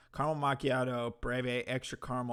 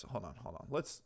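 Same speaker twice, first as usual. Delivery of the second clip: ordinary delivery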